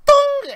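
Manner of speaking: loud high metallic thung sound; pipe clang. Acted. Russian clobbering someone with pipe, loud clang. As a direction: mimic a pipe hitting a head, loud and sharp. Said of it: "he just goes".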